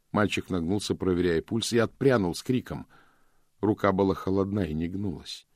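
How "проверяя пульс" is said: ordinary delivery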